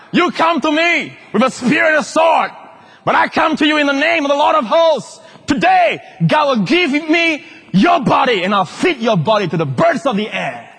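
high, bellowing war cry at Goliath; David's battle shout. Acted. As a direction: roar it at the giant like a shepherd boy gone fierce. Preacher acts David's battle cry.

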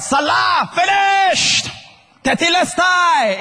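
high, sustained shouts; loud final cry. Acted. Preacher showing how Jesus cried again, shouting. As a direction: cry out each word high and long. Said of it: "He cried again in a loud voice".

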